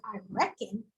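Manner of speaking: quiet, puzzled "I reckon" falling away; softly bewildered echo; puzzled repeat. Retold or imitated. Teacher echoing "I reckon", quietly puzzled. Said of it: "And I was like".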